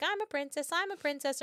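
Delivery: a hint of a playful daughter's chant; little girl. Little daughter dancing in her mother's dream, lightly childlike. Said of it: "her daughter was, like, dancing".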